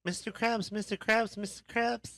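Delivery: high-pitched cartoon voice calling out; cartoon character impression. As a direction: squeal it excitedly like a cartoon sidekick. Impression of SpongeBob calling Krabs.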